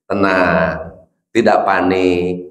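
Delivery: low, slow and unbothered; calm father. Retold or imitated. Father staying cool when the child falls, low and slow. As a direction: settle it low like a calm dad.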